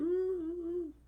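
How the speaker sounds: high, soft troubled "ooh"; troubled wince. Retold or imitated. Bruce Willis reacting after a shooting, high soft pained ooh. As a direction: wince as if you just shot someone.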